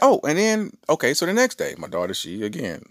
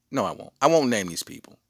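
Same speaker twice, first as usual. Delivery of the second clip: usual speaking voice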